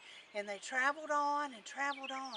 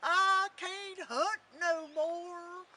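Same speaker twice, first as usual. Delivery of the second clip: high, very slow, wailing dog voice; howling old hound; 6 semitones higher, 3 dB louder, much slower than usual. A narrator's reading. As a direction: stretch the words into a hound's howl. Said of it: "hollered that old hound dog".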